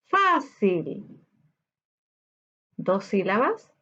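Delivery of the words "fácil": "Fácil" split into fá and cil; "Fácil" said slowly, syllable by syllable. Shown as a demonstration. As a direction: sound out "Fácil" in two syllables, the first one higher. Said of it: "Separemos por sílaba fácil".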